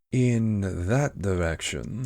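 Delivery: slow, drawn-out cat voice; Cheshire Cat. Acted. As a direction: stretch the words out like a leisurely storybook cat. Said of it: "the cat said".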